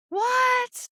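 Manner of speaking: high, squeaked disbelief; a shocked, squealed what; stunned what. Retold or imitated. Stunned reply in retold chat.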